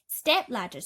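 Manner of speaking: ordinary delivery, nothing marked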